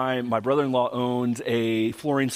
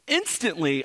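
ordinary talk, nothing marked

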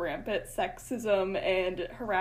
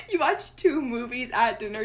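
ordinary talk

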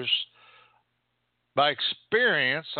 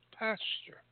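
breathy whispered Pasture; soft whispered echo of the word; 8 semitones higher, 11 dB quieter than usual. Acted. Repeats the word softly.

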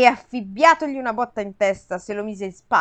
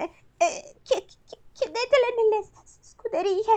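high, whimpering stutter; stammering; 12 semitones higher, 4 dB quieter than usual. Acted. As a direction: babble it out in panic. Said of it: "farfugliò Euristeo". (balbettio acuto e piagnucoloso)

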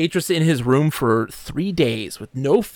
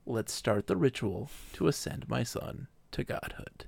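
much softer, flat repeat of the same demand; quiet deadpan repeat; 11 dB quieter than usual. Retold or imitated. Gen repeating himself to the villagers, soft and deadpan. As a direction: say it again quietly, bored and unmoved.

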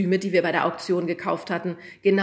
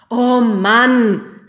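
loud, much higher exasperated cry; exasperated exclaim; 6 semitones higher, 15 dB louder than usual. A narrator's reading. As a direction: groan out loud at the kids. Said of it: "rief der Lehrer".

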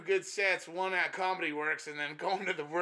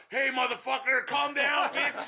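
higher, louder mock thug shout; mock thug yell; 9 semitones higher, 4 dB louder than usual. Comic telling a crowd to calm down, mock thug shout. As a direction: play the Mexican thug and bark it. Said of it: "I guess I'll be like".

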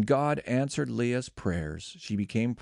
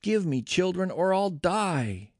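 mildly desperate, higher-pitched plea; narrated plea with a touch of desperation; pleading; 7 semitones higher than usual. A narrator's reading. Rachel begs Jacob for children, pleading in a raised pitch. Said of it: "She pleaded with Jacob".

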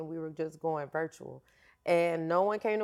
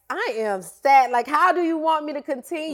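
retold crying; 7 semitones higher, 6 dB louder than usual. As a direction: replay the breakdown, half-crying and pleading. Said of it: "I started crying".

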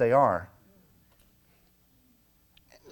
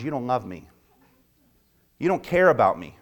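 normal talking voice

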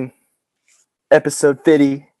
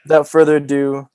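normal talking voice

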